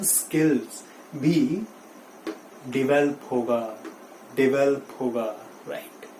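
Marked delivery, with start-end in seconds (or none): 2.7-5.2 'डेवलप' as di-VEL-up, stress on vel; short di, stressed vel in 'डेवलप'; right pronunciation. Shown as a demonstration. Teacher modelling for students, right pronunciation di-VEL-up. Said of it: "डेवलप नहीं होगा".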